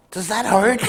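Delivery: teasing question through a laugh; laughing taunt. Retold or imitated. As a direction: call it down with a chuckle. Teasing pitcher from the stands.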